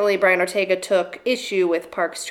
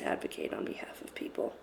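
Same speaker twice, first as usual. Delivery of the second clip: whispering; 15 dB quieter than usual. Real, not acted. Unvoiced low line.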